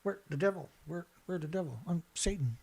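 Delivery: backwards Satan voice. Retold or imitated. Mocking the experts' sentences as a devil's message, eerie soft voice. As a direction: murmur it like a satanic message played in reverse. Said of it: "if you play 'em backwards, it probably says".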